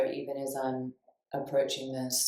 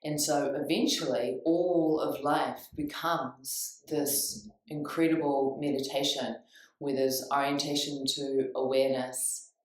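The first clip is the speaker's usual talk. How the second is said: usual speaking voice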